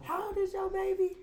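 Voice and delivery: high, curious questioning voice; high curious quote. Retold or imitated. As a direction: ask it brightly, surprised. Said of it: "she like".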